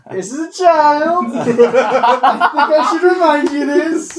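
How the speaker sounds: high, loud horrified cry; shocked shout. Acted. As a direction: yell it in horror, laughing. DM voicing horrified character.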